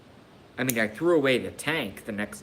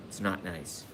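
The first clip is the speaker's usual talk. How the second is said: whispered; 3 semitones lower, 8 dB quieter than usual. Real, not acted. Repeats line softly to herself.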